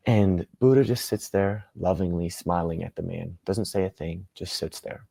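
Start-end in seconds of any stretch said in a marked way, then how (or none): none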